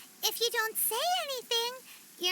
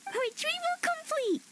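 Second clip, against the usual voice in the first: high, cheery mission-done call; triumphant announcement; 6 semitones higher than usual. Acted. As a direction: announce success, pitched up high. Announces the found box in triumph.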